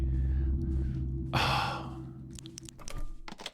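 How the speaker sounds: drawn-out breathy sigh; long exasperated sigh. Real, not acted. Streamer hears the car was taken, long exasperated sigh. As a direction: let out a long, fading sigh.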